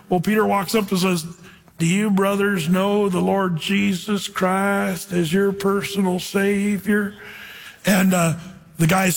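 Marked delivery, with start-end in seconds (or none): 1.8-7.1 a light, slow hint of a southern drawl. Pete asking the huge guys about Jesus, mild Southern drawl. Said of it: "he talked really slow like this with a southern drawl".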